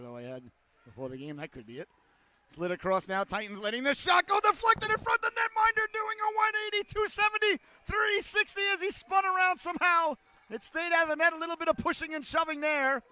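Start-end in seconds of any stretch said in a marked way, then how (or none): 3.2-13.0 high, loud excited hockey call; excited play-by-play. Real, not acted. Broadcaster calling a shot deflected at the net, excited play-by-play. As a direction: call the scramble at full voice, pitch way up. Calls a near goal live.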